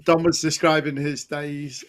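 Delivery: ordinary talk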